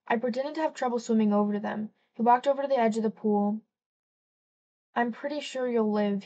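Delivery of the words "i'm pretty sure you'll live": usual speaking voice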